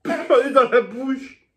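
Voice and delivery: slow, high, laughing words. Real, not acted. Mask in mouth, laughing.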